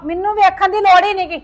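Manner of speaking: angry retort. Acted. Mother retorts.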